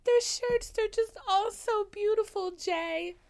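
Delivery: high, loud, melodramatic tearful wail; mock crying. Daisy crying to Jay over the shirts, mock sobbing wail. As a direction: play the weeping heroine, hammed up. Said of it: "And then she starts crying".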